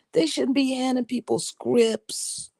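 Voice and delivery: ordinary talk, nothing marked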